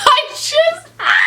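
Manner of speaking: high cry breaking into a laugh; laughing scream. Real, not acted. Vlogger overwhelmed by the book, shrieking laugh.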